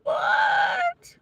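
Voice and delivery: very high, breathy disbelieving yelp of "What?"; shocked what. Retold or imitated. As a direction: squeal it in total disbelief. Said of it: "I'm just like".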